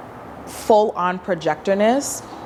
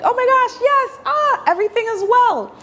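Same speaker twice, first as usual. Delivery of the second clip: much higher, squealing giddy delight; giddy mock excitement. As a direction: gush like an overexcited fan. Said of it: "I don't mean lit up like".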